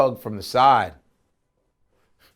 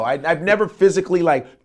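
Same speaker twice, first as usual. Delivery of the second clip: usual speaking voice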